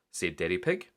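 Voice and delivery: ordinary delivery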